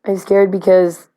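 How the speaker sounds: normal talking voice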